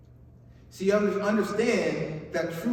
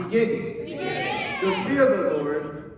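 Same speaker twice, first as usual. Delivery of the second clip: rallying call-out; 3 semitones higher than usual. Shown as a demonstration. Preacher getting everybody to repeat the word, rallying call-out. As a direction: lead the crowd in saying BEGINNING, pitched up. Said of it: "Now everybody".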